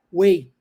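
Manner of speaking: "way" said exactly like the word way, with no G H sound; teacher modelling "way" for the spelling W E I G H; model pronunciation of weigh. Shown as a demonstration. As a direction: say "way" and keep the G H silent. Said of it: "não se pronuncia o G H".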